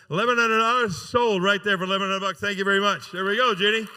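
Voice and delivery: proud sold-it announcement; auctioneer's sold call. Real, not acted. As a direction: bang the sale shut for the crowd. Auctioneer closing the sale.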